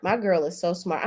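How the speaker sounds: ordinary delivery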